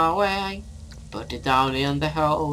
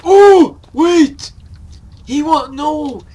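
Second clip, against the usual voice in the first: high loud sudden realisation; surprised shout; 10 semitones higher, 14 dB louder, slower than usual. Real, not acted. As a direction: burst out with a discovery.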